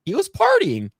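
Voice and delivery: incredulous. Real, not acted. Incredulous emphasis, pitch jump.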